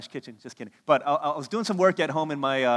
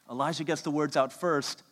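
ordinary delivery

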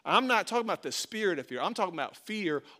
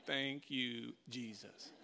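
relieved aside; 6 semitones lower, 14 dB quieter, much slower than usual. Retold or imitated. Dad relieved after slide.

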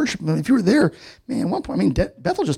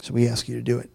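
prayer; 4 semitones lower than usual. Acted. Leads prayer.

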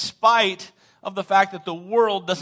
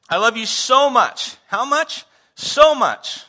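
high, loud, hammed-up declaration of love; big dramatic show of devotion; dramatic flair; 9 semitones higher, 10 dB louder, slower than usual. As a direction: call out question and answer with theatrical gusto. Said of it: "to add some flair".

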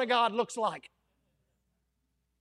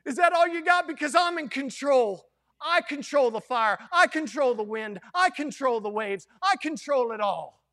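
loud, bold taunt; defiant Jesus; 4 semitones higher, 5 dB louder than usual. Acted. Jesus mocking the king from the furnace, loud and defiant. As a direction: throw it back at the fire.

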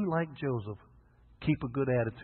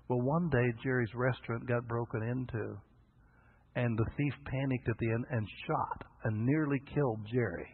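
ordinary talk, nothing marked

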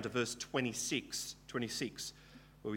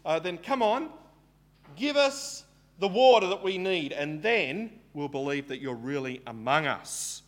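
higher, louder sarcastic challenge; mocking Israelites; 5 semitones higher, 6 dB louder than usual. Israelites taunting God to give them water, mocking challenge. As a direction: taunt God, demanding and cheeky. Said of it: "they're saying".

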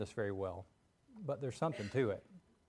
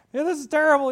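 high, loud panicky outburst; panicked; 13 semitones higher, 13 dB louder than usual. Acted. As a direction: wail it as a frantic Scarecrow. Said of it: "left there to panic".

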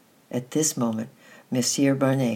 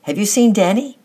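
loud, high call into an empty house; 8 semitones higher, 10 dB louder than usual. A narrator's reading. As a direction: shout anxiously for a missing boy. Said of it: "she called".

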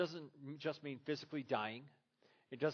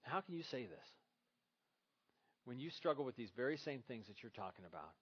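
inner voice; 3 semitones lower, 8 dB quieter than usual. Retold or imitated. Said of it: "I thought to myself".

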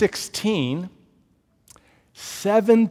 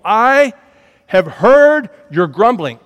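slow, loud, much higher divine verdict; God's booming voice; 6 semitones higher, 12 dB louder, slower than usual. Acted. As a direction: pronounce it like God himself. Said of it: "God says to the people".

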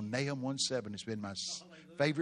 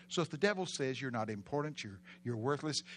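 ordinary delivery

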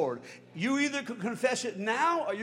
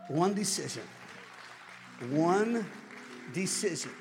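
hushed preaching; 5 semitones lower, 4 dB quieter than usual. Real, not acted. Preacher repeating the key phrase softly, hushed. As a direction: let each word land quietly. Soft slow repetition.

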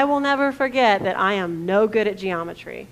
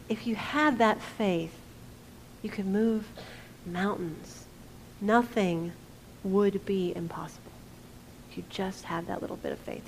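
tender encouragement; 3 semitones lower, 8 dB quieter than usual. Retold or imitated. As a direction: reassure them gently, letting it fade. Jesus encouraging disciples.

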